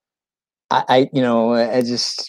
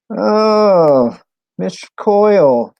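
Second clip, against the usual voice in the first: high, loud, drawn-out hail to a friend; delighted greeting; 9 semitones higher, 6 dB louder, much slower than usual. Real, not acted. Streamer spots a friend in chat, delighted hail.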